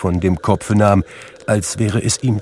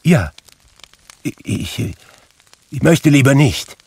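reluctant fear; 7 semitones higher, 4 dB louder than usual. A narrator's reading. Scrooge voice.

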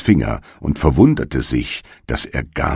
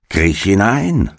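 slow, higher-pitched witch voice; witch's command; 5 semitones higher, slower than usual. A narrator's reading. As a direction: coax her in slowly as the witch. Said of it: "sagte die Hexe". (langsam lockende Hexe)

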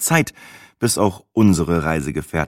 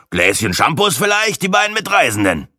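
smug show-off; 9 semitones higher than usual. A narrator's reading. Braggart bossing the group, cocky. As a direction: lay down the law, cocky.

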